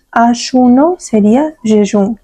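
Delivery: ordinary delivery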